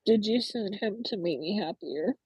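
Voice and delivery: ordinary talk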